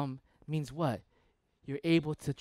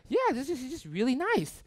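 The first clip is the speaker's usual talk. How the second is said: higher, naive insistence; high girl voice; 9 semitones higher than usual. Retold or imitated. Girl insisting again in a higher voice. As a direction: defend him in a high voice. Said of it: "It's like".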